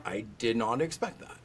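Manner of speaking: retold surprise. Vet reacting to unexpected positive tests, understated surprise. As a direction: quietly quote your own surprise. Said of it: "And you're like".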